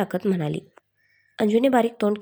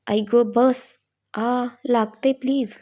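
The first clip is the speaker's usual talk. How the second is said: a pained whimper, voice slowing; whimpering under the blows; 2 semitones higher, much slower than usual. A narrator's reading. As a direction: moan it out as the pillows hit. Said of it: "ती कणतच म्हणाली".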